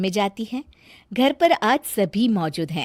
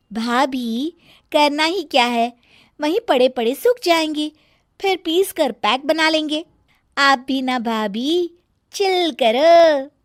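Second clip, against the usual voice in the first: teasing younger sister; 6 semitones higher, 4 dB louder than usual. Acted. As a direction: tease her sister-in-law sweetly. Sonam answers cheekily.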